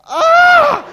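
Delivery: very high, loud shocked scream. Retold or imitated. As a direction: shriek at the sight of blood. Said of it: "and then I think".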